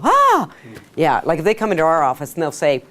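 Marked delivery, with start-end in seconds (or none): 0.0-0.5 sudden high excited "Ah!"; excited gasp. Shown as a demonstration. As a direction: blurt it out, way up high. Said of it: "You get so excited, you go".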